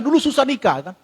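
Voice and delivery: ordinary talk, nothing marked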